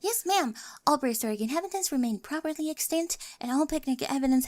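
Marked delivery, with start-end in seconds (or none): 0.0-0.5 mock salute. Mock military report.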